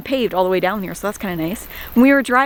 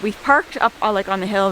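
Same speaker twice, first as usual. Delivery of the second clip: normal talking voice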